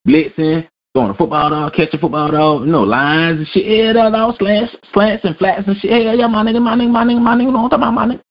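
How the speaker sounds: high, excited bluffing voice; high mocking impression of a bluffing player; mock Jamarcus Russell. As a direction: play the lying player eagerly faking it.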